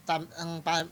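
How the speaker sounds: usual speaking voice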